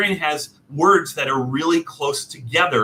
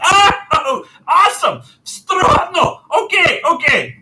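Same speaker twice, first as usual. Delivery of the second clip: high, loud, overjoyed okays; delighted relief; 6 dB louder, slower than usual. Retold or imitated. Customer thanking the clerk, high and loud with joy. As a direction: burst out happy. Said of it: "I was like".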